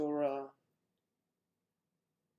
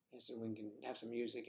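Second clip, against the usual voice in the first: ordinary talk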